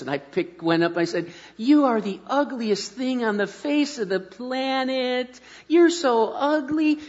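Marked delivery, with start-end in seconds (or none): 1.6-7.0 high, slow, cooing voice with harsh words; sweet baby talk. Shown as a demonstration. Dad cooing sweetly to his baby daughter. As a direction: coo insults sweetly to the baby. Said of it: "It's more important how you say it".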